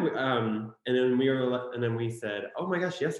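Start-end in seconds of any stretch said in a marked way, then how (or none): 2.6-3.2 higher, excited confirmation; raised pitch, amazed agreement. Retold or imitated. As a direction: act out a thrilled yes. Retold excited answer to stranger.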